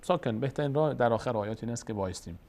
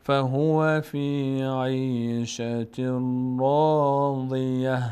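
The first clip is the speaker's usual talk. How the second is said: slow, louder, melodic tajwid recitation; Quran recitation; 3 dB louder, much slower than usual. Shown as a demonstration. Teacher reciting the surah for students, slow chant. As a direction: chant each verse slowly with long held vowels.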